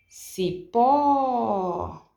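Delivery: CIPÓ with a long, loud-held final PÓ; calling out at gate. Shown as a demonstration. Teacher demonstrates stressed syllable to students, calling CIPÓ with PÓ prolonged. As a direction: call CIPÓ as if at the gate, stretching the last syllable. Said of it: "chamando essas palavras no portão".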